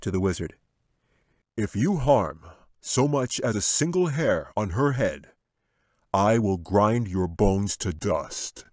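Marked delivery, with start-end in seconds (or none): 1.6-8.6 loud, slow menacing threat. Acted. Angel threatening the wizard, loud and slow. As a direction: grind out every word.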